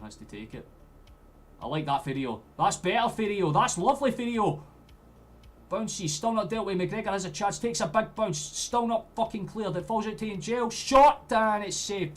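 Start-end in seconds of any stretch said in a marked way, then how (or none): 1.6-4.6 rising, much higher and louder each time; growing delight at the winger; excited praise. Real, not acted. Excited reaction to good play. 5.7-12.2 much higher and louder, peaking on the shot; frantic live goalmouth call; excited play-by-play. Real, not acted. Live chance in the box.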